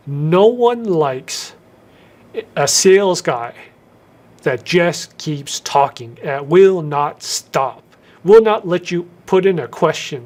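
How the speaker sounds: slow, drawn-out phrases with long pauses between them; slow right down and leave long gaps. Shown as a demonstration. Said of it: "learn to use strategic pauses".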